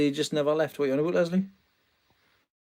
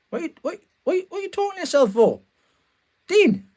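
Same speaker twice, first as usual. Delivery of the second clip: put-on much higher little-girl voice calling out; neighbour's little girl calling Dean over the fence, high mock child voice; imitating a little girl calling; 14 semitones higher than usual. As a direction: call over the fence like the kid next door. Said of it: "little girl from next door was shouting over".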